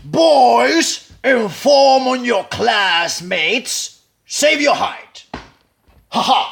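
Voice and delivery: put-on loud, high, slow Pacino-style address to the boys; imitating Pacino. Coach imitating Pacino mocking the boys, loud and slow. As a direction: drag out each word like a sneering colonel. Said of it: "I wanna imitate Pacino".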